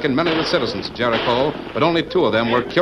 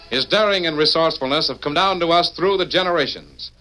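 ordinary delivery

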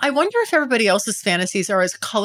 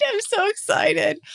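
excited; 12 semitones higher than usual. Real, not acted. Host gushing about her upcoming date.

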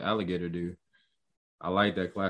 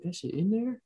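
very high, rising question; incredulous double-take, pitched way up; surprised disbelief; 12 semitones higher than usual. Retold or imitated. Fan doubting he saw the Thanos chopper, surprised. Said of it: "I'm surprised they put it in there".

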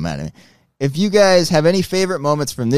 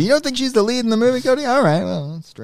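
mocking quote; 10 semitones higher than usual. Mocking a self-absorbed actress.

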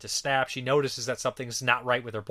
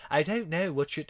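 lightly mocking English accent, pitched higher; heavy English accent; 4 semitones higher than usual. Reviewer imitating the sister's entrance, heavy English accent. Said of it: "her sister has this heavy English accent".

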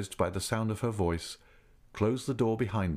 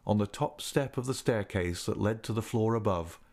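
plain reading voice

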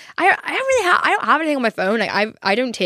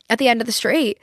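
ordinary talk

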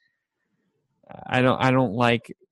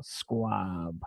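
savoring the word; 5 semitones lower, 10 dB quieter than usual. Retold or imitated. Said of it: "he's in love with the sound".

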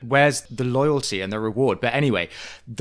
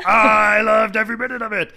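high, loud, shouted boast; mock proud shout; 10 semitones higher, 8 dB louder than usual. Co-host imitates Vicky shouting that she loved it, high shout. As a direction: yell it out with no shame. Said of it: "And she's like".

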